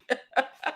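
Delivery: short amused laugh. Real, not acted. Laughing at the sister's remark about the smell. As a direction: let a real laugh burst out.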